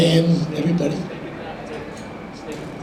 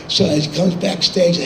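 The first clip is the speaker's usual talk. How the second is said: usual speaking voice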